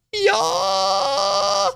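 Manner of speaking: long, very high "yeah" yell; high hype shout; excited cheer. Real, not acted. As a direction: let out a drawn-out high-pitched whoop. High cheer after naming Souls-likes.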